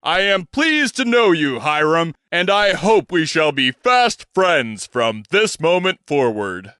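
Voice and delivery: a pompous stage gentleman's introduction; grand old showman. Acted. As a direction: present yourself like a theatrical impresario. Bostwick welcomes Hiram.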